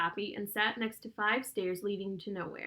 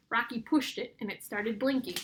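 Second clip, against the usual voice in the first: usual speaking voice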